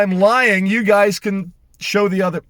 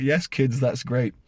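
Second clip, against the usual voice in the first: softer, lower, weary sarcasm; deadpan reply; 4 semitones lower, 5 dB quieter than usual. Retold or imitated. Father answers his cheerful kids, dry sarcasm. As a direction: mutter it dryly to the kids. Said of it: "And I'm like".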